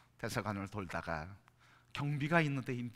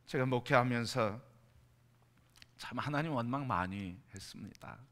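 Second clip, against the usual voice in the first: ordinary delivery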